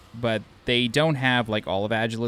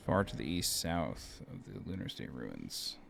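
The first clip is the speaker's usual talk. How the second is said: much lower, slow, deep read; 8 semitones lower, 10 dB quieter, slower than usual. Acted. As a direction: drop the voice deep and intone it. Repeats the same text, much deeper.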